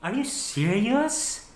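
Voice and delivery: high, soft, drawn-out question; stunned, slowly rising question; shocked disbelief. Acted. Partner reacting to breakup news, in shocked disbelief.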